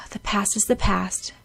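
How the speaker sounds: ordinary delivery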